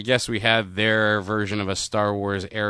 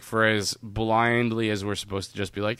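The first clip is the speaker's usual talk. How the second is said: ordinary talk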